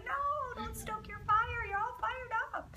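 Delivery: a teacher voicing her inner panic at a student; mock inner plea. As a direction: squeak it like a silent inner protest. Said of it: "I was like".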